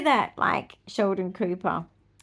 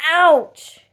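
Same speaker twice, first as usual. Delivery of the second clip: mock ouch; 8 semitones higher, 14 dB louder than usual. Recalling childbirth pain.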